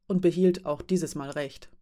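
ordinary delivery

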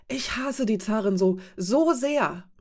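höher und lauter, voller Hass auf die Zarin; leidenschaftlicher Ausruf. A narrator's reading. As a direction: mit wachsender Wut herausrufen. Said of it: "rief Mara aus". (Mara ruft ihren Hass auf die Zarin aus, laut und erregt)

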